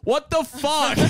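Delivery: high-pitched mock disbelief; shocked driver. Driver climbing out to a shattered windshield, acted shock. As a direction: imitate a stunned driver.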